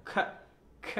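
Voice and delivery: খ as a short isolated burst of air; breathy kh, খ alone; kh sound. Shown as a demonstration. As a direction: pop out a crisp aspirated খ. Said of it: "খয়ের মতো".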